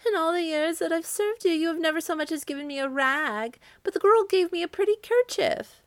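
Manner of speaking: very high, fearful servant voice; timid servant daring to answer back; trembling servant. A narrator's reading. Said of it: "trembled the servant".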